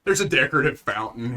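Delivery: ordinary delivery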